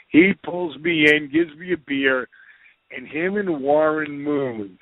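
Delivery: ordinary delivery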